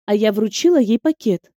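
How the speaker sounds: usual speaking voice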